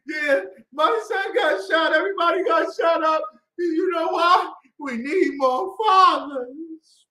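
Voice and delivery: high, loud, wailing interviewee; mock grieving mother. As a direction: imitate a mother crying out to a reporter. Acts grieving mother interviewed on news.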